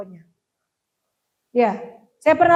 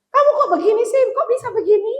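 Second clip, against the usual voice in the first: put-on higher, louder, grilling a child with rapid questions; interrogating parent; 9 semitones higher, 4 dB louder, faster than usual. Parent interrogating child about misbehavior, higher and louder. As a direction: fire off questions like a suspicious parent. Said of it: "modelnya interogasi".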